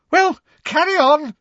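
loud, shrill burst near hysteria; high-pitched hysterical. A narrator's reading. Professor Trelawney ordering her class on, hysterical. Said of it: "her voice high-pitched and somewhat hysterical".

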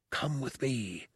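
soft, hushed and pressing; urgent whisper. Acted. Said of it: "the monk whispered urgently".

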